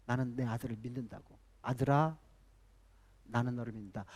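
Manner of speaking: soft, low fatherly words; tender reassurance. Retold or imitated. Bill Gates's father telling his son he believes in him, tender and soft. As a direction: say it softly, like a trusting father.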